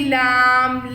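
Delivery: لام then a clear ل sound on its own; letter sound demo. Shown as a demonstration. Teacher showing children the sound of لام, clear demo. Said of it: "تو لام کی sound کیا بن گئی؟".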